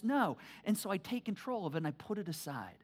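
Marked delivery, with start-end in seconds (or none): none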